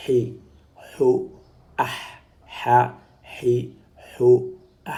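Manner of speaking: smooth, airy throat h in "hi, ho, ah"; "ah" ending in an audible breathed h; undotted h sound. Shown as a demonstration. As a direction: push clean air on each h of "ha, hi, ho". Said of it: "la même lettre sans le point".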